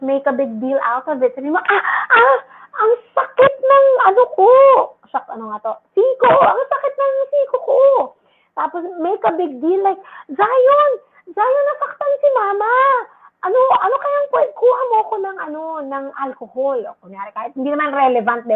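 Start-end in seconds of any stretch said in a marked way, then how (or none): 1.6-4.5 high, whiny mock hurt, making a big deal; hammed-up pain. Shown as a demonstration. 5.9-7.8 high mock whimper about the elbow; hammed-up pain. Shown as a demonstration. Mother making big deal of pain. 10.3-16.8 put-on high, pleading call to the child; mock distressed mother. Shown as a demonstration. Mother calling child for help.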